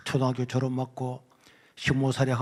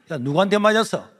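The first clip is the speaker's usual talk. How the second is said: louder, demanding question; stern teacher; 5 semitones higher, 7 dB louder than usual. Retold or imitated. Teacher demanding who hit the crying boy, loud. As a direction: bark it at the student.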